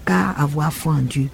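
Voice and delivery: ordinary delivery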